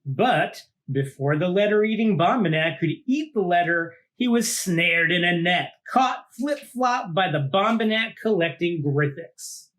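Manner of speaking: lively read-aloud storytelling voice; storybook narration. A narrator's reading. Host reading a monster picture book to kids, lively storytelling. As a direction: read it out like to a child at bedtime.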